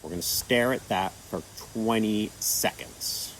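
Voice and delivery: normal talking voice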